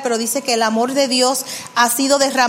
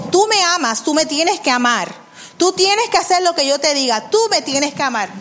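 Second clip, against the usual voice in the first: higher-pitched, insistent demand; orden exigente; 4 semitones higher than usual. Acted. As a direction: press it as a command, not a question. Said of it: "Dios no te dice eso". (orden exigente, en tono más agudo)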